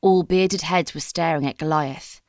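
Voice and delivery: usual speaking voice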